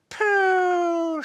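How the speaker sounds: put-on long, high, loud baby cry of the word. Baby copying Bill about the smelly nappy, high and long. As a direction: wail it like a toddler.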